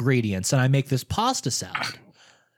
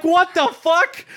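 very high, slow disbelief; incredulous outburst; 12 semitones higher, 4 dB louder, slower than usual. Retold or imitated. Caller hung up on by brother, incredulous outburst. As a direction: stretch it out in amused shock. Retelling shock after being hung up on.

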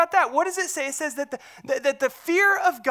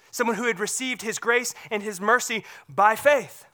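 ordinary talk, nothing marked

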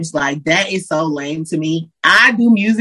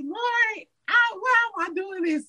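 pleading prayer; 13 semitones higher, 9 dB quieter than usual. Retold or imitated. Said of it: "And it's like".

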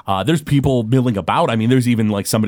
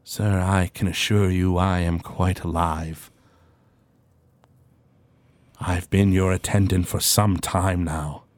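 slow, formal manservant; a patient butler humoring his master; polite attendant; 4 semitones lower, slower than usual. Acted. Attendant gently insisting to Jandar he is alive.

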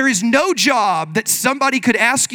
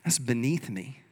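a quiet, lowered sniff of disdain; snooty refusal; 7 semitones lower, 7 dB quieter than usual. Retold or imitated. Churchgoer turning up their nose at a chore, quiet and snooty. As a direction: say it like the chore is below you. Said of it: "and be like".

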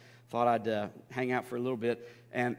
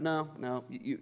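normal talking voice

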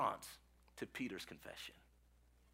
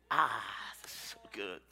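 lower, softer whispered aside; hushed, overcome delight; savouring whisper; 10 semitones lower, 6 dB quieter than usual. Real, not acted. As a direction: breathe it out, relishing the moment. Preacher savouring his own point.

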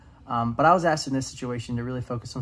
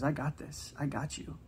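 tender reassurance; 3 semitones higher, 7 dB quieter than usual. Retold or imitated. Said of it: "he's basically saying in this verse, like".

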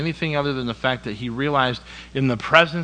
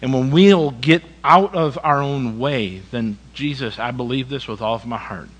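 ordinary delivery

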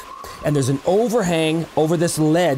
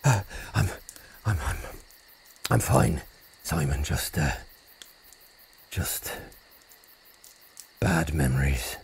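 shaken; 13 semitones lower, 7 dB quieter than usual. Acted. Shaken after nightmare.

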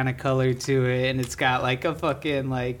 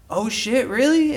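surprised; 10 semitones higher, 3 dB louder, slower than usual. Retold or imitated. Ex-inmate surprised, retold.

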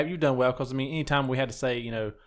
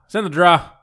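Ohio accent; 5 semitones higher, 8 dB louder than usual. Shown as a demonstration. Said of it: "draw for your drawer".

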